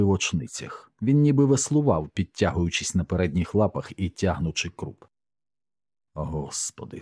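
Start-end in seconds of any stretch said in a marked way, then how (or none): 6.1-7.0 low, soft exclamation; stunned, hushed. A narrator's reading. Comrade seeing the burning dog, stunned and hushed. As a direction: let it drop to a hushed, shocked murmur.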